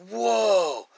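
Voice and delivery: high, loud, drawn-out whoa; retold amazement, voice jumping up; awed whoa. Retold awe on seeing the angel.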